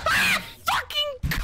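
high, loud drawn-out yelp; startled scream. Real, not acted. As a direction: scream out a curse in shock. Startled by horror game jump scare.

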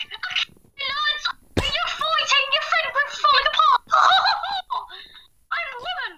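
put-on high falsetto girly voice, giggling; hammed-up high woman voice with a laugh; mock girl voice. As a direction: play the woman in a squeaky falsetto. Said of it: "Please stop the voices".

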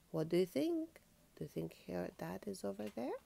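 ordinary delivery

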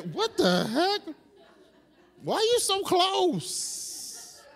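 drawn-out, high-pitched incredulous recoil; mock weirded out. Preacher acting out reaction to a stranger whispering in his ear, mock weirded out. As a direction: stretch it out in high, comic disbelief.

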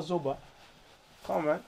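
usual speaking voice

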